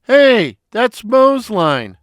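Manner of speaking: high, loud indignant protest; indignant hey. Acted. Curly objecting that the patient used Moe's line. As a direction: object sharply, offended.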